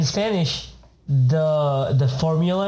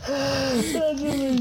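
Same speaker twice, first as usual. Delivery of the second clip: laugh-crying; 12 semitones higher, 4 dB quieter, slower than usual. Real, not acted. Cracking up.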